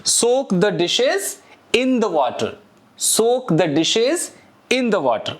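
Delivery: slow, careful English model sentence. Shown as a demonstration. Teacher modeling an English phrase slowly for students. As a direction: spell it out slowly for learners. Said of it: "अपना normal word आएगा soak".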